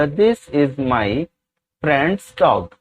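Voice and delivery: each word spaced out slowly for students to copy; very slow, deliberate classroom dictation. Shown as a demonstration. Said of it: "teacher dictates English sentence".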